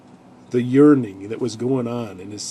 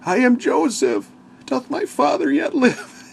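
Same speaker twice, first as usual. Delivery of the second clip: emotional reveal; 4 dB louder than usual. Retold or imitated. Joseph reveals himself to brothers.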